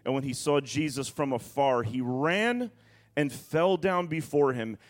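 dramatic pulpit reading, stretching weighty words; emphatic scripture reading. Acted. Preacher reading the storm and tombs story to church, emphatic preaching.

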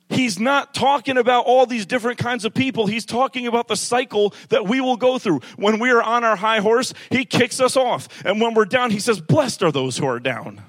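loud, high, driving sermon build; preaching at full force. Acted. Preacher rousing her church, loud and high. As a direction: thunder it at the congregation.

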